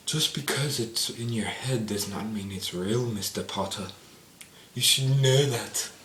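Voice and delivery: slow, hushed wizard-mentor voice; Dumbledore. Retold or imitated. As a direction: draw it out like an imitated old mentor. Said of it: "And Dumbledore's like".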